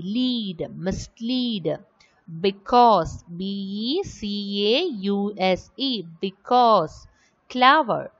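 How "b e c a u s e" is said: letter by letter, evenly spaced; spelling out letters. Shown as a demonstration. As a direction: spell each letter out separately.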